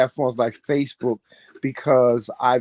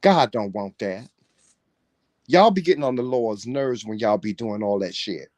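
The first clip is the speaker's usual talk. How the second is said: ordinary talk, nothing marked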